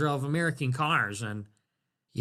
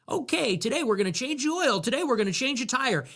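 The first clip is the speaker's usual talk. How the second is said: mock demo host; 7 semitones higher, faster than usual. Mock live car demo host.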